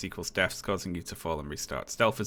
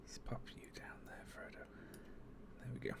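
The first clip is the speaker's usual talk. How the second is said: whispering; 5 semitones higher, 19 dB quieter than usual. Real, not acted. Streamer muttering while moving overlay.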